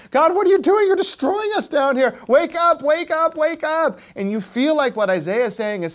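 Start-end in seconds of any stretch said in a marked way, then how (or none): 0.1-4.0 higher and louder, crying up to heaven; the pot yelling at the potter to wake up; desperate shout at God. Retold or imitated. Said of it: "You're the pot on the potter's wheel saying".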